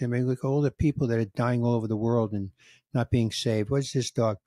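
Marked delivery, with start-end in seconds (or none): none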